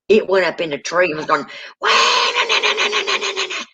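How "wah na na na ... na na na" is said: high, squealing wah-na-na of a slaughtered pig; mock squeal of a pig at slaughter; imitated pig squeal. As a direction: squeal it like a pig being slaughtered, fading to a breathy chatter. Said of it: "Sounded like a pig being slaughtered".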